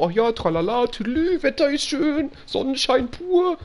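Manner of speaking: high, breezy tralala chatter; mock cheerful obliviousness; hammed-up carefree babble; carefree singsong. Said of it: "so geredet hab wie jetzt, so".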